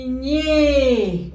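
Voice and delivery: ÑE released long from the held sound, falling; stretched, emphatic ÑE; syllable blending. Shown as a demonstration. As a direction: swell into ÑE as the sounds collide. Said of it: "hasta que choquen".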